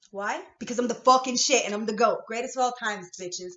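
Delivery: loud, proud bragging yell; boastful shout. Real, not acted. To her viewers, bragging she's the goat, shouting. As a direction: crow about being the greatest.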